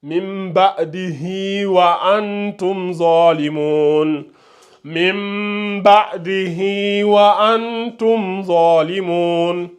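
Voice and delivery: slow chanted recitation, the vowels drawn out; tajwid recitation. Shown as a demonstration. Teacher demonstrating recitation to students. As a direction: recite it slowly with the connecting elongation. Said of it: "c'est le mad de connexion".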